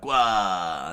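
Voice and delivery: loud open-mouthed cry; a loud cartoon battle cry; wide-mouthed scream. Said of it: "as he screamed out".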